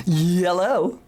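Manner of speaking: yellow drawn out slowly as a hello, rising; slow, teasing "yellow" in place of hello; joke "yello" greeting. Retold or imitated. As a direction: stretch yellow like answering the phone to annoy someone. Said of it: "I was greeting her adult contacts".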